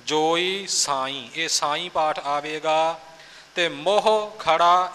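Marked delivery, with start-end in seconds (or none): 3.6-4.9 ਮੋਹ ਖੜਾ run together as one word, slow; joined together. Shown as a demonstration. Teacher shows the words said joined, slow careful reading. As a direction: join ਮੋਹ ਖੜਾ without a break. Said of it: "ਇਹ ਵੱਖ-ਵੱਖ ਨਹੀਂ ਕਰਨਾ".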